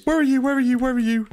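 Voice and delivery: nervous calling out. Real, not acted. Searching in dark game.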